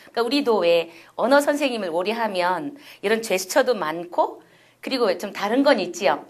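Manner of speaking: ordinary talk, nothing marked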